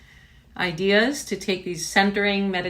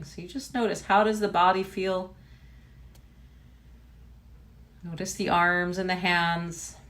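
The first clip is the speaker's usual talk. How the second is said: unhurried body-scan voice, long pauses; name each body part, then leave space; guided meditation. Real, not acted. Teacher guiding students to notice body and breath, guided meditation.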